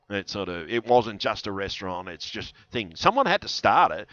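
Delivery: normal talking voice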